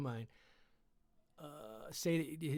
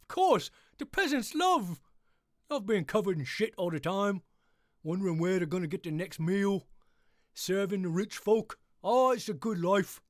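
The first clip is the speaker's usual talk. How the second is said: sarcastic happy peasant; 3 semitones higher, 4 dB louder than usual. Acted. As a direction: gush about misery like a grinning peasant. Mock-cheerful peasant describing misery.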